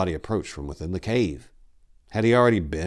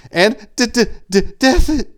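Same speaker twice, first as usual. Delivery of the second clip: terrified stutter; 17 dB louder than usual. A narrator's reading. Ameneth stuttering the news of the slaughter, terrified. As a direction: stammer out the deaths in panic. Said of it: "he stuttered".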